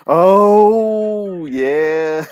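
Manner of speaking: long, loud, high-pitched "oh" of recognition; drawn-out cry as it comes back to you; real burst of delighted remembering; sudden excited recall. Real, not acted. Suddenly remembers a bad example.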